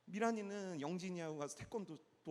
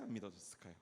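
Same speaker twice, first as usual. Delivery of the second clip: ordinary talk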